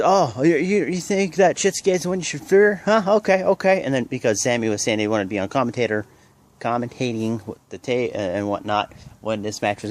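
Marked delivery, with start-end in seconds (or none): 0.0-3.8 mocking heel. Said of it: "pretty much says".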